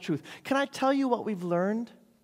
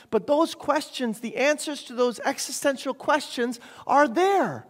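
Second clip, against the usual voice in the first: raised, louder preacher's voice, pressing question after question; impassioned preaching; 6 semitones higher, 5 dB louder than usual. Real, not acted. Preacher to congregation on humanity's search for truth, impassioned. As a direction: preach it out high and strong.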